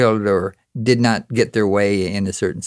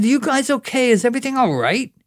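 high, quick worried questions; concerned questioning; 13 semitones higher than usual. Acted. Poet checking on his shocked friends, high concerned questioning. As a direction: ask urgently, pitched well up.

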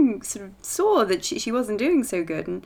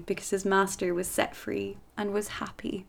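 hushed ending; 8 dB quieter than usual. A narrator's reading. Whispered story ending.

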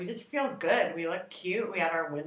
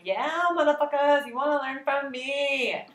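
higher, louder swaggering brag; cocky boast; 6 semitones higher, 4 dB louder than usual. Retold or imitated. Speaker retelling her post-talk swagger, cocky. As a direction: gloat at the crowd. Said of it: "I was like".

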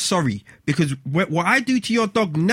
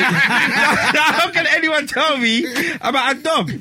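laughing disbelief; 8 semitones higher, much slower than usual. Real, not acted. Laughing disbelief about dog.

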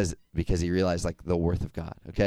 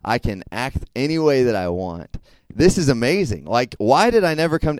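loud, giddy voice of a man living as he pleases; excited carefree boast; 2 semitones higher, 7 dB louder than usual. Retold or imitated. Youth speaker voicing a carefree man, loud and giddy. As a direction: crow about total freedom.

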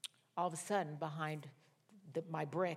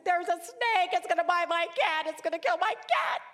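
high, loud re-enacted shriek; comic frantic yelling, pitched way up; panicked screaming; 12 semitones higher, 9 dB louder than usual. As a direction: scream the alarm in hammed-up panic. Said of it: "I started screaming at the top".

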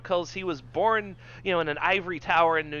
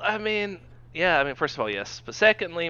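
usual speaking voice

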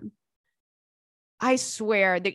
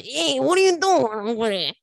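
loud, higher New Jersey mobster voice; Tony Soprano impression; 4 semitones higher, 4 dB louder than usual. Drunk at the bar, talking like a New Jersey mobster. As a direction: play a New Jersey Italian-American wiseguy. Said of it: "[name] starts speaking Italian".

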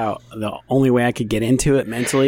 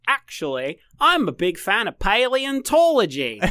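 slow, lightly voiced Australian accent; a hint of a precise Australian accent; 10 semitones higher than usual. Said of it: "working on my Australian accent".

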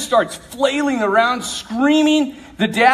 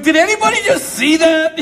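laughing, a bit louder, asking the room; can anyone believe this, laughing; stunned disbelief; 5 semitones higher, 4 dB louder than usual. Retold or imitated. Priest shocked at communion reaction.

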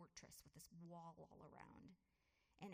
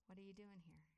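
soft repeated questions; 6 dB quieter, faster than usual. Retold or imitated. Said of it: "ask me those two questions".

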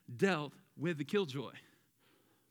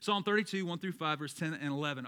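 usual speaking voice

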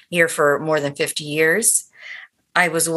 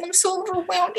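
high, softened retold gushing; high-pitched mock-overwhelmed quote; imitating overwhelmed fan; 12 semitones higher, 3 dB quieter than usual. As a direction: mimic a fan overcome at meeting her idol. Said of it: "like, trembling".

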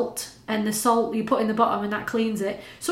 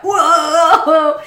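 mock amazed whoa; 6 semitones higher, 9 dB louder than usual. Friend seeing posh house.

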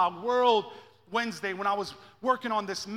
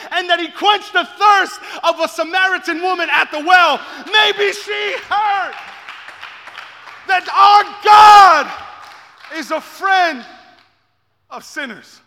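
rising, shouted preaching, much louder; preaching at full force; 5 semitones higher, 10 dB louder than usual. Acted. Pastor tells the church what the woman heard, shouting in full preaching. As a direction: build the congregation up to a roaring climax.

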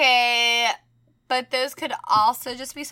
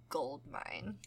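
whispered aside to oneself; very soft, low mock scheming whisper; hushed sly realization; 5 semitones lower, 18 dB quieter than usual. Seller realizing a haunted doll will sell, sly whisper.

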